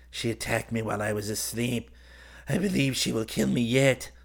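put-on voice, rising in pitch; injured man complaining. A narrator's reading. Wounded man telling visitor of the cat attack, aggrieved. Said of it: "ceasing his groans".

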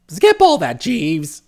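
exasperated employer waving away the detail; impatient interruption. Acted. Employer interrupting his valet's rambling report, impatient. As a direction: cut in briskly on the report.

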